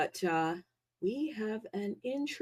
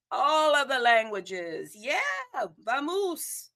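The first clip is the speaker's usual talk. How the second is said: ordinary delivery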